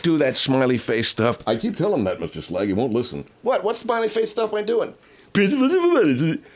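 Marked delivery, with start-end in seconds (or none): 3.4-6.4 goofy put-on voice. Asked about smiley face delivery.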